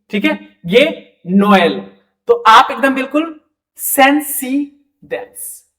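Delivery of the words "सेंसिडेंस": सेंसिडेंस said slowly and evenly, no stretched vowels; simple French demo. Shown as a demonstration. Teacher telling students how to say सेंसिडेंस, simple and slow. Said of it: "pronounce करना है".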